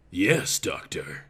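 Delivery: low, slow and soft, sultry; sexy nurse voice. A narrator's reading. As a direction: purr it like a seductive nurse. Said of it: "That voice was so sexy".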